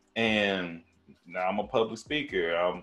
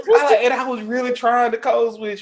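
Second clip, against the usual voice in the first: laughing; 14 semitones higher, 8 dB louder than usual. Real, not acted. Laughing while retelling.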